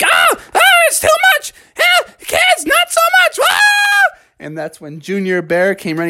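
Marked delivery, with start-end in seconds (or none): 0.0-4.2 very high, shrieking protest; cartoon grandma crying out; overwhelmed granny. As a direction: squeal as if smothered by hugs. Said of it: "she was like".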